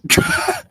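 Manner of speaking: sharp high burst of laughter; quick high laugh. Real, not acted. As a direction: squeal with laughter. Laughing at the natural one.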